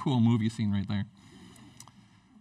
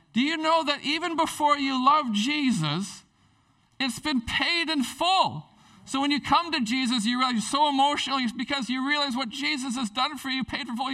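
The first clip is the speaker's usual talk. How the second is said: fervent preaching; 10 semitones higher than usual. Real, not acted. Pastor urging the congregation, fervent high preaching. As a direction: lift them up with the good news.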